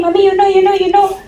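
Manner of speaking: high, loud, whiny you know; complaining daughter. Retold or imitated. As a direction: nag like a sulky daughter.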